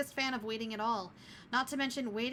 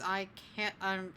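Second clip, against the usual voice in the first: usual speaking voice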